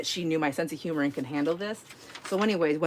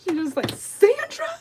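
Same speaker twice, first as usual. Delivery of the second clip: shocked question; 13 semitones higher than usual. Retold or imitated. Friend catches on, shocked high question. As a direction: gasp out her name in realisation.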